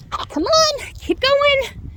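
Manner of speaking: high, bright call to a dog. Real, not acted. Urging dog to move on.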